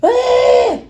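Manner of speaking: sharp high yell of pain; pained shout. Real, not acted. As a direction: cry out from the burning. Burning from hot sauce.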